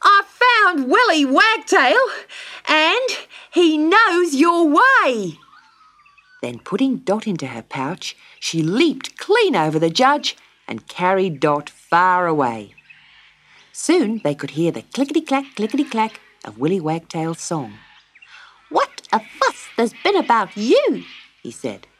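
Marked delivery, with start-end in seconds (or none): 0.0-5.4 high, loud, exultant kangaroo; breathless excitement. A narrator's reading. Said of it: "panting with excitement". 18.7-21.0 high-pitched little bird voice; chirpy wagtail. A narrator's reading. Wagtail scolds fondly, acted bird voice.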